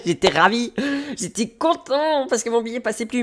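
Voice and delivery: high-pitched mock joy after a laugh; sarcastic delight. Real, not acted. Traveller stuck with an expired ticket, sarcastic delight.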